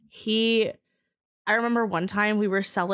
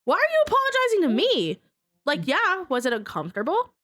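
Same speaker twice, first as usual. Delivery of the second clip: exasperated retort; 6 semitones higher, faster than usual. Retold or imitated.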